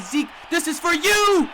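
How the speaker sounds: high, loud, slow triumphant cry; hyped player quote. Retold or imitated. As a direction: yell it like the player dedicating the throw. Quarterback's shot, voiced player quote.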